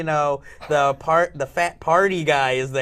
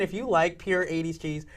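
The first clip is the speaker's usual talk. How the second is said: ordinary talk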